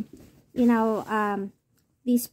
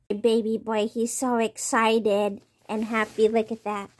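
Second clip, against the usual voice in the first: usual speaking voice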